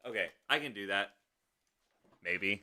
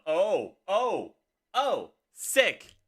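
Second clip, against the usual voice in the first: excited; 7 semitones higher, 6 dB louder, slower than usual. Real, not acted. Sudden in-game unlock.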